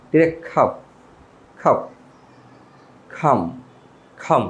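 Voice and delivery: খাফ and খাম, both without L; silent L. Shown as a demonstration. Teacher drills both words for students, silent L. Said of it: "এল এর উচ্চারণ হবে না".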